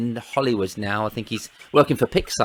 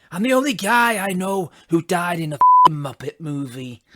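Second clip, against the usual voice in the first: higher, louder Scottish comic voice; Billy Connolly impression; 5 semitones higher, 6 dB louder than usual. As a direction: do Billy in a broad Scottish accent. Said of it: "try and do it in a Scottish accent".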